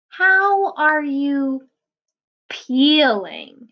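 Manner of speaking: slow storybook title read. Acted. Reading the title to children, slow and drawn out. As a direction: draw out the title slowly for kids.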